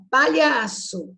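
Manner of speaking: normal talking voice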